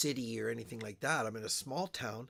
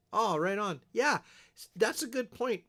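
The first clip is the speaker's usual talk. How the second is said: surprised; 9 semitones higher, 7 dB louder than usual. Real, not acted. Reacts to comment, surprised.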